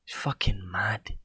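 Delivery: hushed, slow whisper under the breath; whispered aside. Real, not acted. Vlogger whispering to himself about the news, under his breath. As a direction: mutter it to yourself, barely voiced.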